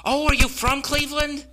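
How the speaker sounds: high, bright mock girl voice; hammed-up valley girl asking; ditzy young girl. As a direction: play the clueless teenage girl. Girl at mall asks question.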